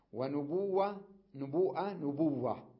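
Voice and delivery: نبوءة with a full hamza against نبوة with a doubled w; slow teacher contrast of the hamza and without it; نبوة with the hamza merged into w; slow contrast of forms. Shown as a demonstration. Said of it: "يعني ألفظها هكذا".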